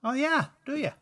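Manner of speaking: high-pitched questioning in an amateur Irish brogue; lilting mock-Irish comeback; put-on Irish accent. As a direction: ask it in a rough Irish accent. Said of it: "Speaking of bad accents".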